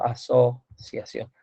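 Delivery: "asociación" drawn out slowly, in pieces; sounding out. Real, not acted. Teacher checking the spelling of asociación, sounding it out slowly. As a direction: break "asociación" up slowly, in a low voice. Sounding out the word being written.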